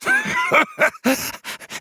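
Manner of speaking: high, shrieking maniac laugh; hysterical laugh. A narrator's reading. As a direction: cackle like a madman. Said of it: "his voice rising to a hysterical pitch".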